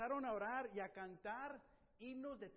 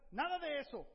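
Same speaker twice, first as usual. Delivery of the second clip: rechazo indignado; 6 semitones higher, 8 dB louder than usual. Acted. Pablo se niega, indignado.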